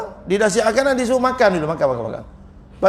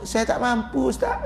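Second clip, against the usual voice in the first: much higher, whiny voice trailing soft on Ustaz; mock whiny excuse; 5 semitones higher, 6 dB quieter than usual. Preacher mocks congregants pleading poverty to him, high whine. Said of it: "kita ni selalu sebut".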